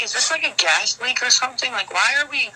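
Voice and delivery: high, baffled quizzing; retold incredulous questioning. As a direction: replay grilling the parents in disbelief. Retells questioning mumbling parents.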